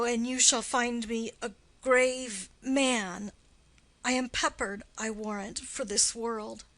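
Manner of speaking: ordinary delivery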